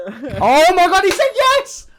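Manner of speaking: very high, loud excited cheer; hammed-up joy. Streamer cheers the mock proposal, loud and very high. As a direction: scream it like the happiest bridesmaid.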